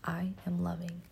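quiet repeated affirmation; soft echo. Shown as a demonstration. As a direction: repeat it softly to yourself. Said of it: "Say it with me".

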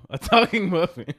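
loud, slow, laughing through it; shouted punchline. Acted. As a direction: shout the punchline as you crack up. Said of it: "The other one shouted".

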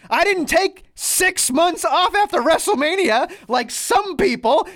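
imitated upset rant, high and slow; Seth Rollins. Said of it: "getting very upset".

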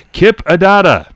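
high, loud host introduction; projected roll-call voice; Bobby Van. Retold or imitated. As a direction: announce it like a game-show emcee. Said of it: "And when he introduces".

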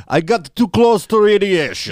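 hammed-up Russian accent; mock Russian accent. Mocking the show's Russians with a fake Russian accent.

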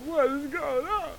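put-on bewildered cry; mock alarm. Homeowner finding someone in the walls, mock alarm. Imagined listener discovering him in walls.

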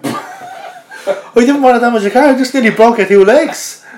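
chuckling, incredulous echo of the man's excuse; laughing disbelief. Retold or imitated. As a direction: repeat his words in amused disbelief.

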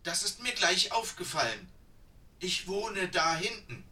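put-on an eager old woman gasping between words; breathless old woman. A narrator's reading. Old woman tells the pilgrims where she lives, high and breathless. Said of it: "Nach Atem ringend".

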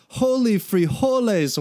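loud, high, slow exclamation; exclaiming. A narrator's reading. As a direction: blurt it out in shock. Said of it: "one of them exclaimed".

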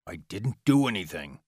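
tense hissed denial. A narrator's reading. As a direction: hiss it through the struggle. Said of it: "Omori hissed".

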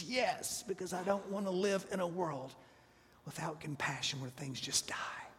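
soft, defeated, fading into a whisper; despairing Jonah. Acted. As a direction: Jonah giving up, his voice sinking away. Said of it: "Jonah is extremely unhappy".

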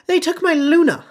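high, loud desperate father; pleading Xenophilius. A narrator's reading. Xenophilius pleads with Harry, high and loud. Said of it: "he whispered".

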